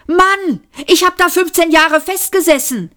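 high-pitched, aggrieved protest; exasperated outburst. A narrator's reading. Ford protests at being stuck fifteen years, exasperated. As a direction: complain in exasperation, pitch shooting up. Stranded man protests to friend.

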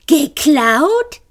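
high mocking echo, far above; mocking imitation. A narrator's reading. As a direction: ape the question in a squeaky voice. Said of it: "äffte Marvin sie nach".

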